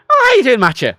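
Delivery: high, loud Irish greeting, "Matthew" as "Matcha"; casual Irish. Retold or imitated. Contrasting the Irish greeting with English, casual Irish. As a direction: toss out an easy Irish hello. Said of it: "It's casual".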